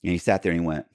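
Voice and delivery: ordinary talk, nothing marked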